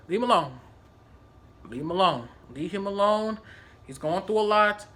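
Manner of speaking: low, softened reassurance; calming. Retold or imitated. Telling Melina to leave an upset coworker be, calming. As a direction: quietly talk her down.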